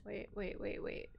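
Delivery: softly muttered; hushed and under breath; quiet. Real, not acted. Stopping herself in game.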